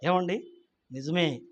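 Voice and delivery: ordinary talk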